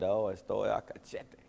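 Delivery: gringo Spanish. Retold or imitated. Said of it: "she just giggled and laughed".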